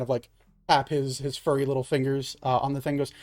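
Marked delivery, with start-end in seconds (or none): none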